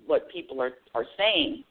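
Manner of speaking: ordinary delivery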